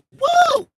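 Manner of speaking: a sudden high, loud whoop; excited whoop. Real, not acted. Streamer whooping at her viewers, high and loud. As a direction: let out a joyful high woo.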